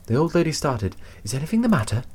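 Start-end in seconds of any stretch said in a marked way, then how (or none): 1.2-2.0 high, breathless anxious question. A narrator's reading. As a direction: ask anxiously in a raised voice. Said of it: "she said breathlessly".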